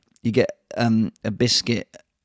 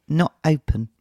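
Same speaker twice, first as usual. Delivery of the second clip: put-on child voice; 6 semitones higher than usual. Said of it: "goes past it and goes".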